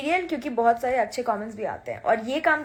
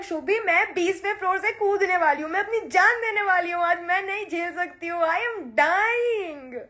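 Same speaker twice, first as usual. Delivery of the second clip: higher, hammed-up wail to a friend; calling friend Shubhi in mock despair, higher; melodramatic despair; 4 semitones higher than usual. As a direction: play the tragic heroine. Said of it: "I was like".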